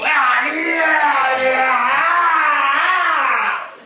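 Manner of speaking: long, high, very loud roar of mock rage; wordless rage yell. Dad too angry to talk before he leaves, raging yell. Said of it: "so angry that he couldn't talk".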